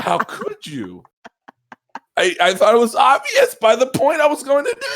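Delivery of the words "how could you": slow, drawn-out mock reproach; imitating her hurt tone. Companion reproaching the player, slow mock hurt tone. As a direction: stretch it out like a wounded companion. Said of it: "the tone she used was like".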